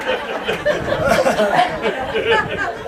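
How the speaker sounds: high, unrestrained laughter at his own punchline; real delighted laugh; big real laugh. Real, not acted.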